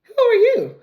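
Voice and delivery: high, loud outraged "Who are you?"; shocked old man. Retold or imitated. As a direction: play him startled and offended at the door. Old man confronted on his porch.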